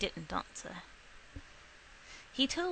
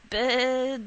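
put-on deaf girl's first word; 3 semitones higher, 7 dB louder than usual. Deaf daughter proudly repeating her first word, loud. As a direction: say "Bird" loud and slightly blurred.

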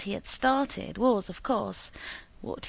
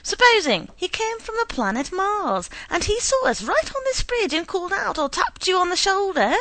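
excited teasing; 10 semitones higher, 6 dB louder than usual. A narrator's reading. Wife teasing with Mars idea.

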